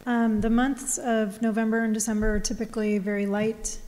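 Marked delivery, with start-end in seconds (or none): none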